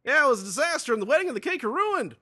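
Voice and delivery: a shrill cartoon voice cries disaster; squeaky upset voice; high loud dismayed character. Another character voiced loudly.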